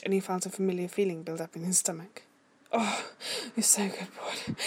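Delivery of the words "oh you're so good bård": groaned whisper; hushed, breathy praise; muffled groan. A narrator's reading. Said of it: "Vegard groaned against his skin".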